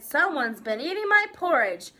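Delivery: lightly voiced Papa Bear, emphatic. A narrator's reading. Albert as Papa Bear finding porridge eaten, loud outrage. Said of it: "said Albert as Papa Bear".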